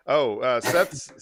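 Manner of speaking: ordinary talk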